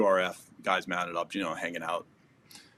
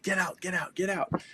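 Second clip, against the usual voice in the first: retold yell; 10 semitones higher than usual. Gunmen ordering people out of the car, retold mock yell. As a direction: imitate gunmen yelling without full volume. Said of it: "they were yelling at these people".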